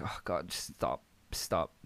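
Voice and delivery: low, soft plea under the breath; weary pleading. Retold or imitated. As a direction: beg quietly for the embarrassment to end. His weary plea while watching, retold.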